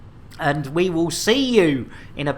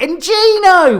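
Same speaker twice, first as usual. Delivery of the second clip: excited shout-out; 11 semitones higher, 8 dB louder than usual. Real, not acted. Greeting a chat viewer loudly.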